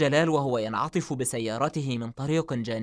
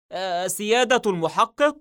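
raised, higher surprised call; exclaiming; 6 semitones higher, 4 dB louder than usual. A narrator's reading. Walid startled by the detective's arrival, exclaiming. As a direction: cry out on seeing the detective. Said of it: "هتف وليد".

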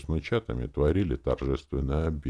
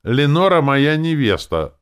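high, loud, insistent claim; united declaration; 12 semitones higher, 11 dB louder than usual. A narrator's reading. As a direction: declare it loudly as if in chorus. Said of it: "заявили они дружно".

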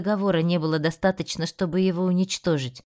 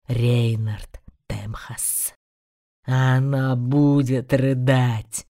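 low, slow menacing demon voice; slow gloating beast, relishing each word; quiet demon growl; 6 semitones lower, slower than usual. Acted. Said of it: "Зверь тихо проревел". (тихий рык демона)

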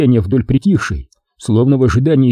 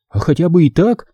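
perky reply, pitched a bit higher; playful retort; 5 semitones higher than usual. A narrator's reading. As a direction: answer back with light, cheeky zest. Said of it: "задорно отозвалась радистка". (задорно, чуть повыше)

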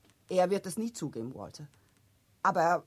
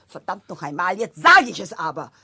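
swear, then blurt it out loud; finally losing patience and shouting; angry outburst; 11 dB louder than usual. Acted. Esther breaking the family silence, loud angry outburst.

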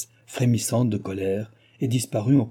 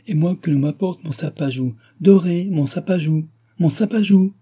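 rising, louder cry for her pet monkey; demanding shout building higher; 7 semitones higher, 6 dB louder than usual. A narrator's reading. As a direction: call out louder and higher each time. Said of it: "cria la comtesse".

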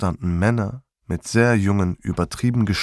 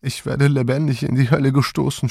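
put-on groaning lament of an old woman, a little higher; moaning grandmother; 5 semitones higher than usual. A narrator's reading. Grandma laments being shut in the truck, moaning. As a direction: moan it out like a despairing grandma. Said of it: "stöhnte Oma".

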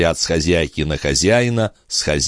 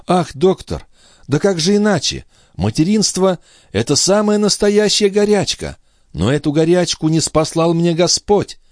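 put-on high-pitched, fervent young woman's voice; impassioned young mother; 11 semitones higher than usual. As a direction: plead the mother's case high and ardently. (высокий, пылкий голос молодой матери)

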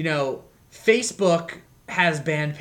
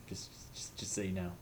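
hushed whisper; whispered aside; 7 semitones lower, 17 dB quieter than usual. Real, not acted. Disclaimer aside to listeners.

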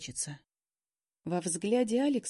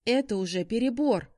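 high, loud indignant exclamation; exclaiming; 4 semitones higher, 6 dB louder than usual. A narrator's reading. She objects to Shaun's behaviour, exclaiming indignantly. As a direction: protest that a line is crossed. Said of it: "воскликнула я".

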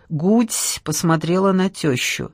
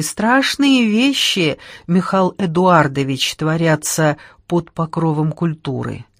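ordinary delivery, nothing marked

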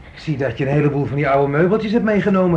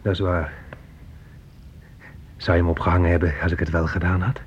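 cold low menace; 8 semitones lower, 5 dB quieter than usual. Acted. Son turns cold and low.

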